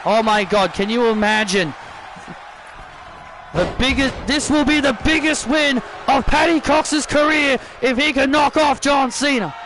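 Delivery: quickening commentator call, building up; excited wrestling commentary; play-by-play call. Acted. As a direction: call the match like a ringside announcer. Live play-by-play of the match.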